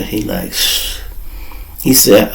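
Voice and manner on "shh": shush. Retold or imitated. Judge shushing.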